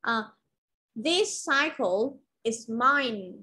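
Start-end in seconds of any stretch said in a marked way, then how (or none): 1.1-3.3 slow This cycle is mine; teacher reads an example sentence for the class; model example sentence. Shown as a demonstration. Teacher models the example slowly. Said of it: "một câu khác là".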